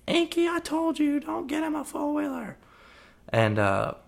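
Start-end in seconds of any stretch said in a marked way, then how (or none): 0.0-2.6 a mock scolding wife, voice pitched way up; retelling the wife scolding Inky, much higher mock voice; mock angry wife. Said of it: "Inky's wife starts yelling at him".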